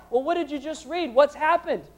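ordinary delivery

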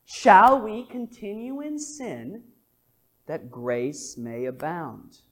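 hushed slow verse reading; hushed scripture. Acted. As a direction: let the verse fall to a hush. Reading verse, fading away.

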